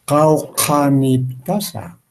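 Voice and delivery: Kaukaniptasa said slowly, syllable by syllable, every sound distinct; slow emphasized articulation. Shown as a demonstration. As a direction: spell out Kaukaniptasa slowly and clearly. (articulación lenta y marcada de Kaukaniptasa)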